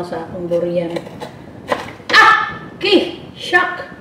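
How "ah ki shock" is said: startled yelp. Real, not acted. Pricked by durian thorn.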